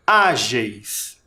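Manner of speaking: weight on the Á of Ágeis; stress on first syllable. Shown as a demonstration. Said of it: "Qual é a sílaba tônica?". (Ágeis com destaque no Á)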